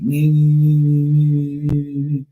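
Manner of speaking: taunting sing-song imitation of nephew; mocking nee nee. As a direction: mimic a teen's mocking nee-nee. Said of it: "And he kept going".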